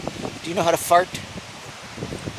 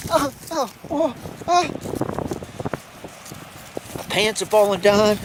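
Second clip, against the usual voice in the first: ordinary talk, nothing marked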